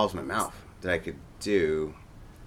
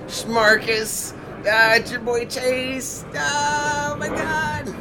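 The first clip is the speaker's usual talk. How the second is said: very high, slow, loud hyped-up voice; impression of Chase; 7 dB louder, much slower than usual. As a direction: squeal it like a hyped buddy. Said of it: "It's my friend Chase Russo".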